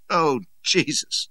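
slow, loud, drawn-out groan; despairing groan. A narrator's reading. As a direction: groan it out, near tears. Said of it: "and I certainly groaned".

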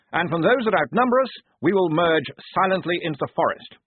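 addressing the men with eager self-importance; the lieutenant briefing his squad; earnest young officer. A narrator's reading. Blouse's plan.

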